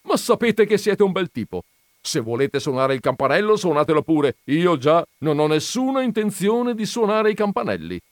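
loud, high, irritated scolding; impatient. Acted. Veneranda telling the gentleman off, loud and impatient. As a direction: dismiss him with irritation. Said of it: "perdendo la pazienza".

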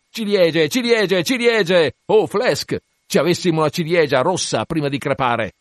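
high, loud repeated cries for cherries; crying out. Acted. Stab cries out for cherries before dying.

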